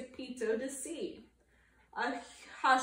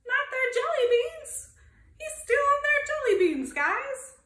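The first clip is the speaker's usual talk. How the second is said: much higher, loud and fast, laughing in disbelief; shocked outburst; 13 semitones higher, 8 dB louder than usual. Real, not acted. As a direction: squeal it out, outraged and amused. Narrator shocked at theft.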